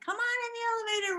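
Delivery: high cheerful invitation; inviting the man back in, high; welcoming call. Retold or imitated. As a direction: sing out the welcome. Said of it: "I'm like".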